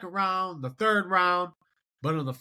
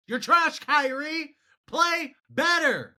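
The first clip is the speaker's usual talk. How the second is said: frustrated shout; 9 semitones higher, slower than usual. Real, not acted. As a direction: yell the demand at Kyrie like a fed-up fan. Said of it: "It's frustrating".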